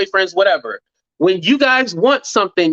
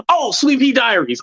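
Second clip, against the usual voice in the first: ordinary talk, nothing marked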